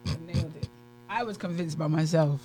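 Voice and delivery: ordinary talk, nothing marked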